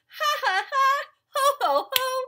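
high, loud acted belly laugh; big storybook laughter; guffawing. A narrator's reading. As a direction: let out hearty ha-has and ho-hos. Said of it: "He ho-hoed and ha-ha'd".

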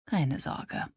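soft, groaned reassurance; groaning. A narrator's reading. Liv to her friend on the phone, a quiet groan. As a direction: moan it out, worn down. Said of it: "stöhnte Liv".

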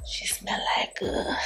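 unvoiced whispered aside. Real, not acted. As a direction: whisper it so she won't hear. Aside about smelly baby.

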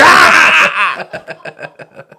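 loud, high villain laugh; hammed-up vampire cackle. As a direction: burst into mock evil laughter. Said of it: "Read the joke like Dracula".

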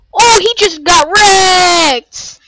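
loud, drawn-out gleeful yell; excited shout. Real, not acted. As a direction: shout it out with delight. Watching a character get destroyed.

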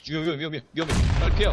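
urgent shouted warning; yelling across the room. Real, not acted. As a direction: yell the danger call loud and high. Teammate warns of grenade. (다급하게 외치는 경고)

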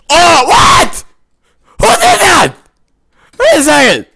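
high loud shocked yell; shrill-free loud startled outburst; shocked shout. Real, not acted. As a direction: cry out in disbelief. Sudden unexpected death.

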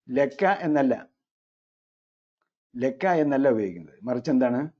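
usual speaking voice

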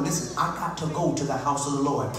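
building from steady resolve to loud demand; defiant sermon cry, gaining volume; preaching plea to devil. Acted. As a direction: preach it with rising force, defiant.

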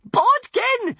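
high, loud shriek of his name. A narrator's reading. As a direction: scream him awake. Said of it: "shrieked Wish".

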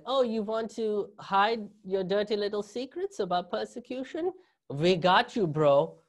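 a mock German official, hammed up; German accent. German authorities to America, a hammed-up German accent. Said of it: "the best German accent you guys are gonna get out of me".

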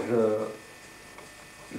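ordinary delivery

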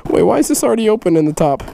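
ordinary talk, nothing marked

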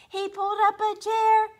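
high and loud; excited. A narrator's reading. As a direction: build the excitement. Excited read-aloud.

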